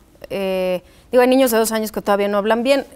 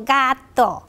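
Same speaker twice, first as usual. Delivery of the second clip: put-on toddler answering. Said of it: "Y yo le digo".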